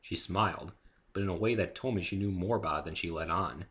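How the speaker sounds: ordinary delivery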